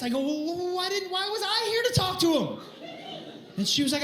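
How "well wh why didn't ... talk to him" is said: high, stumbling mock protest; flustered protest. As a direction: sputter upward in confusion. Husband's flustered protest, acted.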